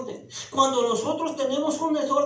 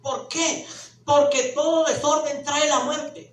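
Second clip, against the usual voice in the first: fiery pulpit preaching; loud soapbox ranting; 2 semitones higher, 5 dB louder than usual. Acted. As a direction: preach it hard to the congregation. Preaching at full force.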